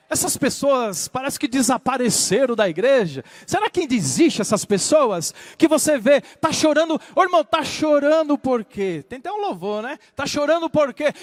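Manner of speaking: loud, high-pitched, driving sermon; fervent preaching. Real, not acted. Preacher urges congregation to weep before God, fervent preaching. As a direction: preach it at full force in long rolling bursts.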